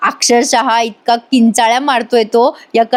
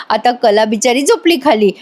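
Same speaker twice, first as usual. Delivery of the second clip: usual speaking voice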